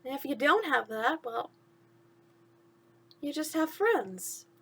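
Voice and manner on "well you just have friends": ordinary delivery, nothing marked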